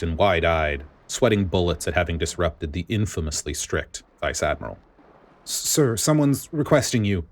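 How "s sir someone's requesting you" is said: nervous stammer, voice pitched higher; young soldier stammers out the message, pitched higher. Acted. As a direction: stumble on the first word, nervous. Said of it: "the soldier stammered".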